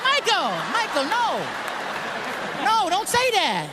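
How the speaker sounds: pleading stop. Retold or imitated. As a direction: beg him to stop.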